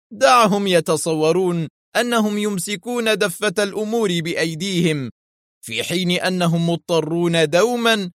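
high-pitched villain relishing his own genius; Yuri, gleeful. A narrator's reading. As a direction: crow with delight, stretching the key words. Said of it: "يقول في جذل".

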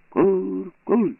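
high yelled chant; choir yelling. A narrator's reading. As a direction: yell it so the street echoes. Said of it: "хор репетує".

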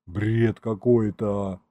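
slow, dazed moan; weary groan. A narrator's reading. Grandson hearing the tangled alibi, slow weary groan. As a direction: drag it out in bewildered despair. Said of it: "простонал я".

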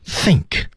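"Think" given weight, falling; stressed key word. Shown as a demonstration. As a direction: lean firmly on "Think". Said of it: "也加了重读啊". (重读"Think"，语调下沉)

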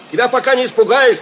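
ordinary talk

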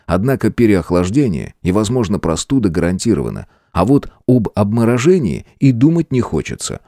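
ordinary delivery, nothing marked

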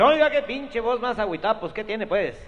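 high, loud jovial voice; cheery compadre. Acted. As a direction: boom like a happy drinking buddy.

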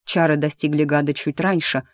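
ordinary delivery, nothing marked

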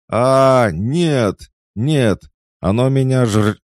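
very high, loud screams, cut off; terrified screaming. A narrator's reading. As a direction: scream as if being eaten alive. Man being devoured screams.

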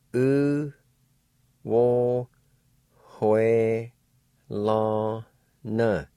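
each syllable said apart, U wo hue la na. Shown as a demonstration. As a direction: spell out U, wo, hue, la, na in separate beats. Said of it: "like this".

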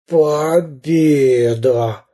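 very slow, groaned victory; groaning. A narrator's reading. Beaten pirate captain claims victory, groaning. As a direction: moan it out, long and laboured. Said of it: "простонал капитан Буль-Буль".

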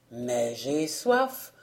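voix grave et lente du père; il réclame sa bière, grognon; père assoiffé. A narrator's reading. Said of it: "s'écriait le père". (le père grognon, voix grave et lente)

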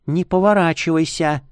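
a voice heard inside the head; urgent warning from inside his mind; whispery telepathic voice. A narrator's reading. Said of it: "Голос прозвучал в голове слишком громко". (телепатический голос в голове)

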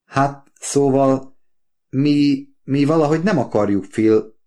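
high, halting then rushed; blurted out. A narrator's reading. Daughter stammers her refusal to her father, halting and high. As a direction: force the words out hesitantly. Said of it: "nyögte ki".

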